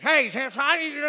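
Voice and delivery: put-on loud, high slurred drunk's holler; hammed-up drunk man shouting for the stewardess; drunk man calling out. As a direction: bellow like a drunk passenger wanting service.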